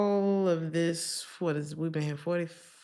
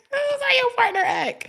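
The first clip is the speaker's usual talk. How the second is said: much higher and louder mock shriek; 9 dB louder than usual. Real, not acted. Telling viewers their partner acts like a child, shrieked. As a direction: shriek the punchline in a put-on voice. Punchline repeated shrill.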